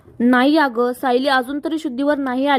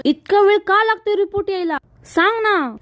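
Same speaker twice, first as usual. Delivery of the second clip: higher, pushing angry question; angry demand; 7 semitones higher than usual. A narrator's reading. Arjun demands the report from Ashwin, angry and higher. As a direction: snap at the brother for answers. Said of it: "Arjun खूप चिडलेला असतो".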